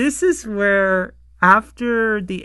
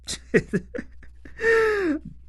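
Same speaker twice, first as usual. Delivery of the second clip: laughing up high; high laughter. Real, not acted. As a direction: burst out laughing. Cracking up at the egg.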